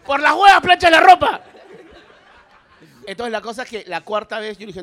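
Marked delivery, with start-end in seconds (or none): 0.1-1.4 mock shout. Punchline shouted in quote.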